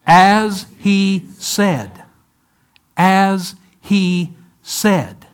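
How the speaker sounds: very slow, drawn-out "As he said"; slow unison recitation. Shown as a demonstration. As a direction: stretch each word as the congregation joins in. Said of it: "Say it with me, would you?".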